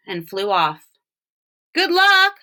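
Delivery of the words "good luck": called out higher and louder; boy calling farewell to a duck, higher and louder. A narrator's reading. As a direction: call it after the departing duck. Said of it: "Walter called to him".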